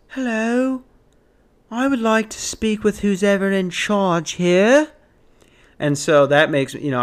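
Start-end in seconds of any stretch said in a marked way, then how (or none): 0.1-4.9 high-pitched British lady. Retold or imitated. British lady asking for whoever's in charge, high British voice. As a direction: put on a higher, mock British accent. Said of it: "I'm gonna do the British voice".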